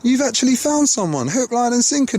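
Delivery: high, drawn-out mock disbelief; astonished realisation, retold. Watching the crafty old man approach, acted astonishment to himself. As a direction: play up the amazed inner voice, pitched high. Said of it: "I'm thinking to myself".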